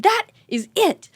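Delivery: high, emphatic exasperated-mom outburst; mock yell at squabbling kids; retold yelling. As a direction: lay down the law, high-pitched. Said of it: "while I'm yelling in the car".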